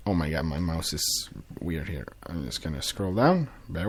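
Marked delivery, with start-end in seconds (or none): none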